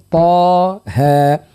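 Taa and Haa each held long; elongated Taha. Shown as a demonstration. Teacher asking listeners to repeat Taha, both vowels drawn out. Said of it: "الطاء مدوها حركتين والهاء مدوها حركتين".

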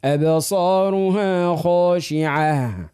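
very slow chanted أبصارها خاشعة with a full ending; full final H. Shown as a demonstration. Teacher modelling for the girl, slow chanted خاشعة. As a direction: stretch خاشعة out to a breathed final H. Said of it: "كيف، كيف؟".

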